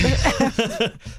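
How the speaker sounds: loud, high burst of laughter; sudden shrieking laugh. Real, not acted. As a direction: let a big laugh burst out.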